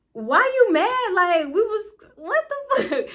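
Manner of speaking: high, slow, squeaky why you mad; high-pitched mock confusion at a sulking friend; mock incredulous. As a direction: play it up, high and baffled. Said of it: "And I'm like".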